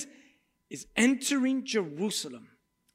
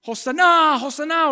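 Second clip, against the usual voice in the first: crowd shout; 8 semitones higher, 7 dB louder than usual. Retold or imitated.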